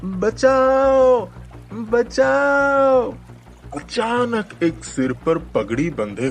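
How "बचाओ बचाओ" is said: high, very slow drawn-out cries for help; drowning and calling out in panic; cry for help. A narrator's reading. Drowning boys crying out for help, high and drawn out.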